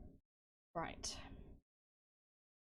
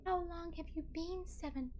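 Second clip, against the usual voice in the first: high melodramatic heroine; dramatic reading; 9 semitones higher than usual. Acted. Streamer as Twilight heroine asking his age, high and dramatic. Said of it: "that is a dramatic reading".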